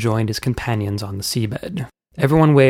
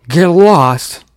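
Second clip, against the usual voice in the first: loud, higher-pitched snarky shout; angry uncle; 6 semitones higher, 9 dB louder than usual. A narrator's reading. Uncle pointing at each worm as he leaves, loud angry shout. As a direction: fire it back loudly. Uncle returns insults one by one.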